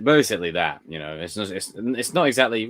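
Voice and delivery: usual speaking voice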